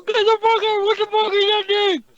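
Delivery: loud, high, stunned outburst; shocked shout. Real, not acted. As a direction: blurt it out in shock. Shocked at a giant dildo.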